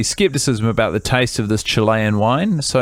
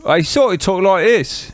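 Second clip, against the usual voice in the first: put-on English accent, higher; [name]'s English accent; 5 semitones higher than usual. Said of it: "I'm just gonna start talking like this".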